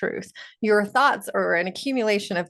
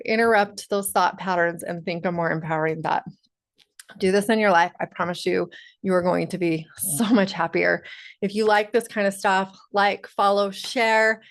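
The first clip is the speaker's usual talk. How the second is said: usual speaking voice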